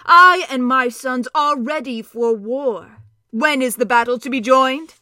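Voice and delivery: a proud warrior ready for battle; noble centaur. Acted. Centaur Glenstorm speaks.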